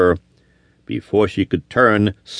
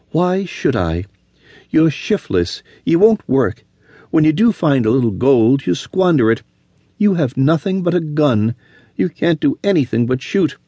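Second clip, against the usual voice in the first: higher, scornful reproach; cold scorn; 6 semitones higher than usual. A narrator's reading. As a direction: list his faults with icy scorn. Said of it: "she queried coldly".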